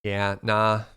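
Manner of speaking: slipping into an Australian "yeah, nah"; light Australian twang; Australian accent. Pretend. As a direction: go briefly Australian, low-key. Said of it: "Turned very Australian for a second there".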